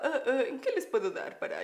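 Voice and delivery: a lightly voiced fake English-accented waitress, laughing; hamming up her own early English, laughing; mock waitress English voice. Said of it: "ya hablaba yo así toda". (imitando su acento de mesera, riéndose)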